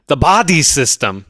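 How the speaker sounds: put-on thick Austrian accent, stressing body; terminator-style accent. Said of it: "his accent is perfect".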